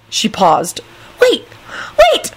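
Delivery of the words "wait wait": short high squeals of sudden realisation; joyful squeal. A narrator's reading. Rosie remembering something mid-moan, squealing joyfully. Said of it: "she squealed joyfully".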